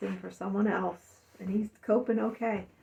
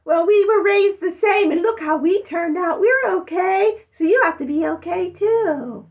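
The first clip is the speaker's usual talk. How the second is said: much higher, loud mocking sister voice; smug older sister; 9 semitones higher, 9 dB louder than usual. Older sister insisting they turned out fine, in a high mocking voice. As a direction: mimic the sister's smug reassurance. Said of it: "some of my older sisters are just like".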